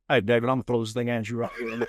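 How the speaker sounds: lightly imitating a smooth Southern host; Jeff Roper voice. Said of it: "this is how he talks".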